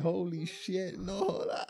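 mock shocked exclaim; retold shock. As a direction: replay the disbelief big. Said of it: "I was like".

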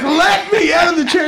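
high-pitched demand to be released; retold protest, half laughing; mock desperate plea. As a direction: plead urgently, pitch shooting up. Acted passenger begging to get out.

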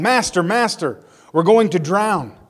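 urgent call, partly acted; mild narrated shout. A narrator's reading. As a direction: lift the disciples' cry without fully shouting.

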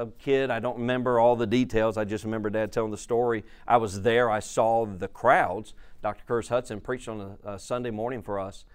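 usual speaking voice

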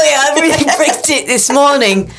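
high, laughing mock Cockney; a jokey, terrible Cockney brag; bad Cockney accent. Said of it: "my accent in the way that it's not good".

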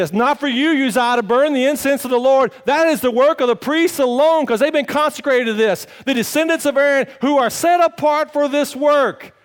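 loud, high preaching rebuke; priests rebuking king. Acted. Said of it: "they said".

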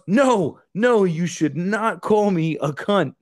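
slow, high, loud no; firm correction. Retold or imitated. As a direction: spell it out slowly and firmly. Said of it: "and she goes".

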